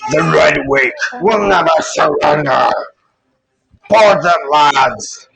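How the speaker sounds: pirate captain rallying his crew; swashbuckler. Acted. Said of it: "and he kind of shouts".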